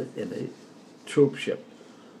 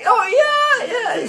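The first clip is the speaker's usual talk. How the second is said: called back; 5 dB louder than usual. Retold or imitated. Reply shouted back across dock.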